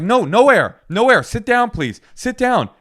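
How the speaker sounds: high, loud mock alarm; retold urgent insistence. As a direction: re-enact frantically ordering someone to stay put. Acting out pleading with fainted wife.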